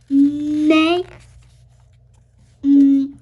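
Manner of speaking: ordinary talk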